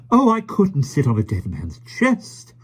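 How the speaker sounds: put-on high, loud shocked old lady; upset lady voice. A narrator's reading. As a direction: gasp it out, scandalised. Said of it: "Miss Lark seemed quite upset".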